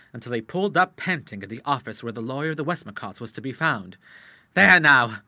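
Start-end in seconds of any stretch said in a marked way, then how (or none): 4.6-5.2 louder, crowing in triumph; a touch of breathless triumph; triumphant cry. A narrator's reading. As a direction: crow over the winning. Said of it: "cried the Admiral in triumph".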